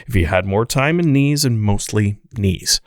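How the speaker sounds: ad read. Acted. Host finishing the sponsor ad, lively ad read. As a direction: sell each beer like a radio spot. Continues ad copy.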